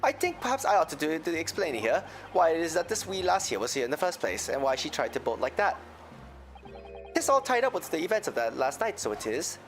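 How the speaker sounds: put-on Irish accent. Voices Irish moneylender McGilded.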